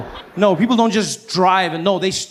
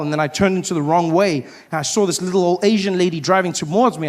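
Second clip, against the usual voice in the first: ordinary talk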